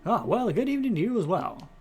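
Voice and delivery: put-on grand old-movie Mid-Atlantic accent. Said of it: "Maybe we'll do Mid-Atlantic accent".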